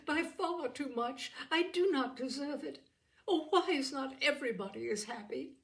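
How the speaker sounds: overwhelmed with happiness, almost disbelieving; liveliest emotion. Acted. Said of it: "acknowledged with the liveliest emotion".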